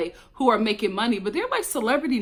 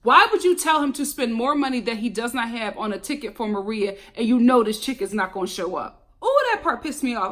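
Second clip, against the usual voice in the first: ordinary delivery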